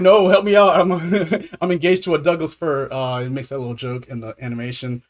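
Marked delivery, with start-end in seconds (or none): 0.0-2.9 loud, high cry for help breaking into laughter; hammed-up, amused protest; laughing mock plea. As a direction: plead for rescue while cracking up. Acts trapped magician pleading, laughs.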